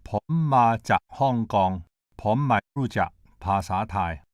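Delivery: slow "Pom ma jak Hong Kong" with careful Thai tones. Shown as a demonstration. Lecturer demonstrating Thai, slow careful Thai tones. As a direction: demonstrate Thai word by word, each tone distinct. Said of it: "I shall now demonstrate Thai".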